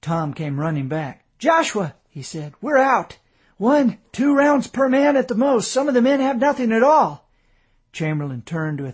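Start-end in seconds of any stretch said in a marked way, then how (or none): 1.4-1.9 higher, louder call; urgent call. A narrator's reading. Tom runs back to tell his brother, urgent call. Brother returns with bad news. 2.6-7.3 higher and louder, alarmed; alarmed report. A narrator's reading. Tom tells the colonel the men are out of ammunition, alarmed.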